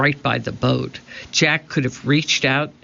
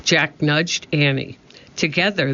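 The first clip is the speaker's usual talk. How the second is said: ordinary delivery, nothing marked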